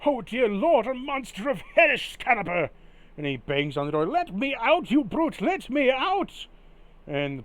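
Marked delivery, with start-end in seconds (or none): 0.0-2.7 high, loud cry of horror; hammed-up terror. Man being shocked by a monster. 4.1-6.5 high, loud, frantic shouting; desperate pleading. Acted. Victim bangs on the door.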